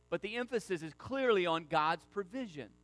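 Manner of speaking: normal talking voice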